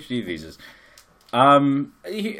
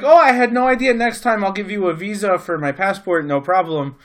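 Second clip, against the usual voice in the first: higher, louder, breezy fake apology; hammed-up clueless promise; mock innocence; 9 semitones higher, 8 dB louder than usual. Tourist placating annoyed supervisor, hammed-up innocence. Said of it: "I was like".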